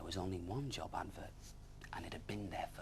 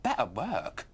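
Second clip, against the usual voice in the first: yawning; 3 semitones higher, 9 dB louder, slower than usual. Acted. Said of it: "yawned Lizzie".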